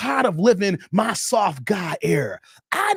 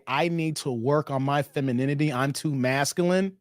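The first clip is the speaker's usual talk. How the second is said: lower, mock woman's confession; 6 semitones lower, 4 dB quieter than usual. Host mocking women on dating show, lightly voiced. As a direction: parody a woman admitting she's too masculine. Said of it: "Any woman that's gonna come out and say".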